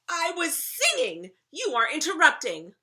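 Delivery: high, loud indignant shout; outraged child hollering; furious yelling. Acted. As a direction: shout back at mom in a tantrum. Child yells back at mom.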